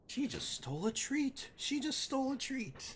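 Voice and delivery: squealed tattling on the dog; high-pitched exclamation. Real, not acted. Exclaiming at dog stealing treat.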